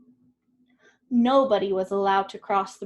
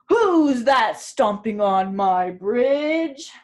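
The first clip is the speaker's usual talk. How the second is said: slow, louder troll roar; 9 dB louder, much slower than usual. A narrator's reading. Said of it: "roared the troll".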